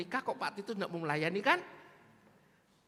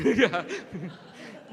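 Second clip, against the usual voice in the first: real burst of laughter; loud laugh; 3 semitones lower, 6 dB louder than usual. Real, not acted. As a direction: laugh at your own temptation. Preacher laughs at himself. (tertawa lepas)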